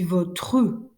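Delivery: "votre" in two beats, final e voiced; two-syllable votre. Shown as a demonstration. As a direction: say "votre" as vo-treu, mute e sounded. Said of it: "ça va compter en deux".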